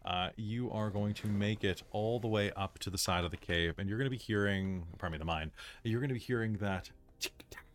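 usual speaking voice

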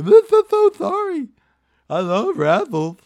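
very high, loud goofy Neanderthal voice; Paul. Retold or imitated. Friend mocking his big wrestler buddy, weird high Neanderthal voice. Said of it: "So we give him that voice".

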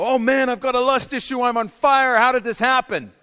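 high, loud mock panic; panicked realization. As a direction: cry out like you just found the fire. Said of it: "wake up one day and go".

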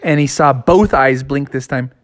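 usual speaking voice

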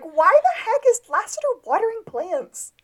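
high-pitched bewildered outcry; baffled, squeaky exasperation; incredulous. Retold or imitated. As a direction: demand an explanation, voice way up. Acted baffled reaction to the scene.

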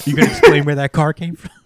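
fast and loud, laughing; teasing the writers through laughter; laughing mock question. Real, not acted. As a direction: rattle it off while laughing. Mocking the story's editing.

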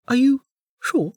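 very high bubbly voice; a cheerful girl babbling apologies; chirpy young woman. A narrator's reading. Niriri apologising to Velika, bubbly and high.

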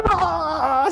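high mock wail of a toddler; imitated struggling squeal. Father imitating daughter fighting her costume, frustrated mock squeal. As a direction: squeal comically, as if wrestling a costume. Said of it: "Seguía luchando ahí con el disfraz".

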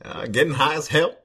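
halting mock-presidential voice with swooping pitch; Obama. President answering an interviewer's question, mock-statesmanlike delivery. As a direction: play a president answering in punchy, broken phrases. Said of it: "Mister Obama, what do you like doing more".

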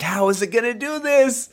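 disbelieving the pig can make it; amazed question. Retold or imitated. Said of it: "I'm like".

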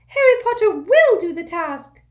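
urgent little servant squeaking; an anxious house elf waking his master; squeaky house elf. A narrator's reading. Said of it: "squeaked the elf".

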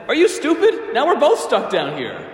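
high-pitched, loud scolding; acted incredulous outburst; exasperated disbelief. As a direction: berate your friend for a foolish move. Man berates friend who jumped in.

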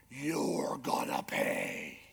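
low, slow menacing warning; mock menacing. Pastor mock-warns congregation who crosses his peeves, low and slow. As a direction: threaten them softly and slowly.

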